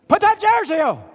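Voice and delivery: sudden high, very loud yell; angry coach's shout. Acted. Coach ordering a player to put his jersey on, furious shout. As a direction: explode at the player at full volume. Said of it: "Here's what Coach Saban or your Georgia coach would have said".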